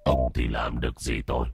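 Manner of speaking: low menacing taunt that leaps into a threat; mock the father like an evil spirit; sinister, contemptuous possessed voice; sneering vengeful ghost. A narrator's reading.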